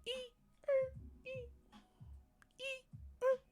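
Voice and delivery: very high, soft squeal; imitated screech. Mocking someone trying too hard, very high soft screech noises. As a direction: squeak it far up, quietly.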